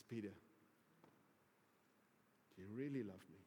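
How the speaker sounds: soft and very low; gentle, searching question. Retold or imitated. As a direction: play Jesus, quietly probing Peter's love. Jesus earnestly asks Peter once more.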